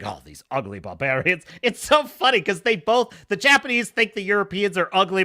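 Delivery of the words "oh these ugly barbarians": lower, sniffy mock disdain; lightly voiced scornful sailor; disdainful Englishman. Blackthorne muttering about the Japanese, lowered mock disdain. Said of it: "John is saying".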